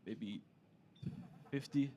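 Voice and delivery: unmoved stone-faced reply; low bored seller voice; deadpan seller. Retold or imitated. Stony Turkish seller.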